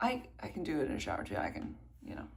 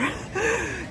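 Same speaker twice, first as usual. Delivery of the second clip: short high laugh after her joke; bright laugh; 14 semitones higher, 6 dB louder than usual. Real, not acted. Runner laughing about the turnaround after her race. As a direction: laugh it off, breathless.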